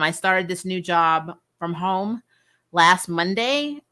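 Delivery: ordinary talk, nothing marked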